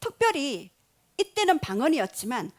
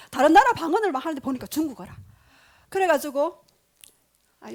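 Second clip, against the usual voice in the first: ordinary talk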